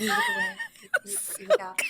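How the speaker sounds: high-pitched, breaking into laughter; laughing while talking. Real, not acted. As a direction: lose it laughing mid-quote.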